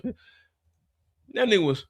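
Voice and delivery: slow, broken by laughter; cracking up mid-sentence; laughing. Real, not acted. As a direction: laugh through the words.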